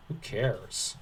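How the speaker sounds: ordinary talk, nothing marked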